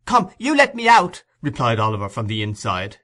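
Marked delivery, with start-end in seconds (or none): 0.1-1.2 put-on boy's voice, much higher and louder; boy yelling through a cellar door to the beadle; child voice. A narrator's reading. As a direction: cry out like a locked-in boy. Oliver shouting through door.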